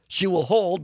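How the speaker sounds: ordinary delivery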